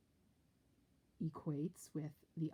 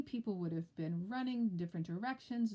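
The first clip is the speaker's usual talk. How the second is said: usual speaking voice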